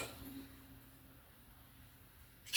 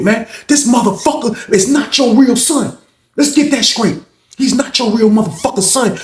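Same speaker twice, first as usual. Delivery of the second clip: high-pitched escalating tirade; angry rant; 9 semitones higher than usual. Retold or imitated. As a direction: keep at him, indignant and climbing in pitch. Acts old head's quarrel.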